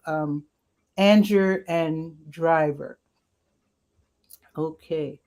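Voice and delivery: ordinary talk, nothing marked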